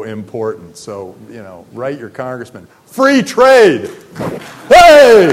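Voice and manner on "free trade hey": shouting. Real, not acted. Rallying cry ending his pitch.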